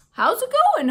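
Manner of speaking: high, loud friendly greeting; cheerful. Acted. Aldo greeting Humphrey, high and loud. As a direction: greet him brightly.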